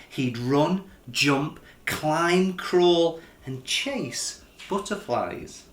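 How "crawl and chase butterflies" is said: ordinary delivery, nothing marked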